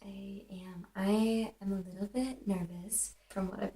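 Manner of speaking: soft whisper, no voice. Real, not acted. Student whispers her nerves before the hammam. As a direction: whisper it like a nervous confession.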